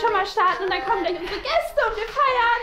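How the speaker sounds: overjoyed, pushing a party; bubbly roommate. Acted. Enid begs Wednesday to party, high and sugary.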